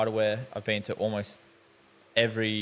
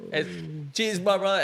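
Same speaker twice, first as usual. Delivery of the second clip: higher-pitched mock gratitude to a gym bro; hammed-up thanks; 8 semitones higher than usual. Lifter thanking the stranger who saved him, hammed-up thanks. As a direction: retell your thanks in a raised voice. Acting out own thanks after rescue.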